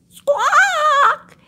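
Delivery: very high, loud squawk; parrot squawk. Acted. Storyteller squawks like a parrot for the children. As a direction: screech like a parrot.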